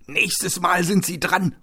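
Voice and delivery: high, loud, drawn-out threat; shouted threat. Acted. Fleeing attackers to their victim, shouted threat. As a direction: call the threat back at him slowly. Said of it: "nicht ohne Hendrik zuzurufen".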